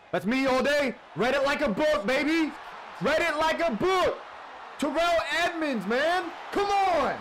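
very high, loud hype yelling; triumphant shout. Real, not acted. Gamer celebrating Terrell Edmonds, triumphant shout. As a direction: bellow your triumph in high bursts.

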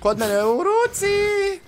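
high, slow panicked yell; drawn-out frantic cry; panicking gamer. Real, not acted. Panicked answer under fire.

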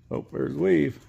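ordinary delivery

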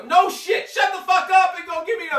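high, loud yell at a fussing bystander; mock yell. Retelling an answer to a bystander about a nailed foot, loud mock yell. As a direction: bark it back at someone stating the obvious.

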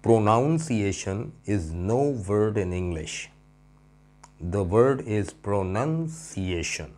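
very slow, every word drawn out; slow careful English. Shown as a demonstration. Teacher slowly models the right English sentence. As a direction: spell it out slowly, pronunciation with nun. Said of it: "अक्सर लोग pronounce गलत करते हैं".

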